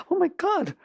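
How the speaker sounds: very high, horrified exclamation; mock horror. Successful person asked what if your child died, mock horror. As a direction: act out dread at terrible news. Acted reaction to child dying.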